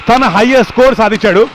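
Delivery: ordinary delivery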